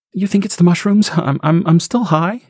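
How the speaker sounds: high, stammering doubt; shaken mother. Acted. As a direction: question herself, shaken. Stacy unsure, stammering.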